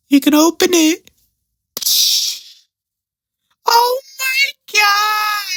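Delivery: squealing very high, drawn-out auntie voice; auntie impression. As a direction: play the over-the-top auntie at the door. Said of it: "I could do a perfect impersonation".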